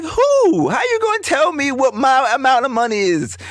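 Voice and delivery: high, incredulous pushback; indignant disbelief. Retold or imitated. To his friend, mocking his doubt, high incredulous. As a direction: sound like you can't believe he said that. Retold argument with friend over money.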